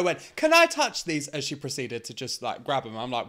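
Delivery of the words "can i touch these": very high, loud gushing imitation; mock excited fan. Someone asking to touch the drag queen, mock excited. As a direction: squeal it as an eager groper. Acted quote of woman who approached her.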